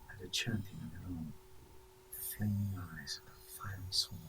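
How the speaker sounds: hushed, ominous whisper; softly whispered foreboding. Acted. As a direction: breathe the words like a dark secret. Ominous lines read in a whisper.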